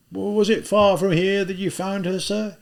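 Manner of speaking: put-on an older policeman clucking over the girl; policeman character.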